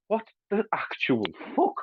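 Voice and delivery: lightly hammed-up disbelief; baffled disbelief. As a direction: play the stunned guard. Baffled guards in retold joke.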